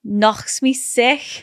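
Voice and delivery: slow, careful Scouse; drawn-out Scouse with a throaty k; Scouse attempt. Shown as a demonstration. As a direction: try out the Liverpool accent slowly. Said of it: "Say that".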